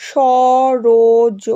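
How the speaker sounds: "Saroj" very slow and loud, each piece drawn out; broken into syllables. Shown as a demonstration. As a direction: stretch "Saroj" into separate syllables. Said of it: "উচ্চারণের সময় যে ভাঙা টুকরোগুলো". ("সরোজ" টুকরো করে টেনে টেনে)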